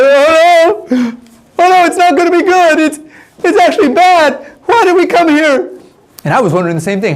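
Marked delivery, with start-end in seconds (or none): 0.0-5.7 loud, high-pitched hammed-up wail; mock crying. Adults scared of giants, mock tearful wailing. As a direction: wail it like panicked adults, exaggerated. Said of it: "then the adults started crying".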